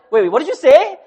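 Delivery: loud, high startled double-check; surprised. Retold or imitated. Preacher reliving a convert's unexpected yes, surprised. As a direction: ask again in loud disbelief. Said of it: "I was so surprised by that".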